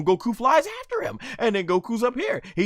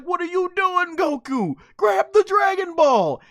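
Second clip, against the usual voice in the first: exasperated yell; 8 semitones higher, 4 dB louder than usual. Real, not acted. Fan yelling at Goku to grab the ball, high and loud. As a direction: yell at the screen in frustration. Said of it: "I'm like".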